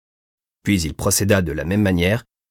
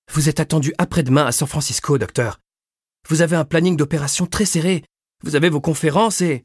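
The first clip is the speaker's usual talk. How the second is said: insistent; 7 semitones higher than usual. A narrator's reading. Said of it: "L'infirmière revint à la charge".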